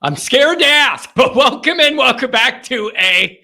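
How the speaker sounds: loud, punchy radio host welcome; show opener. Acted. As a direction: belt out the welcome to your listeners. Opens the live radio show.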